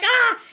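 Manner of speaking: short, high, loud excited scream; retold scream. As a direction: let out a re-enacted shriek. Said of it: "I started screaming upstairs".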